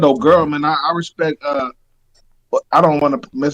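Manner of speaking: usual speaking voice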